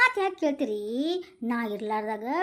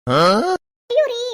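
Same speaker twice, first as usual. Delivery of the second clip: long loud wailing ayyo; loud slow lament; drawn-out wail; 8 dB louder, much slower than usual. Acted. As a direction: wail it out slowly. Wife wails over hurt back.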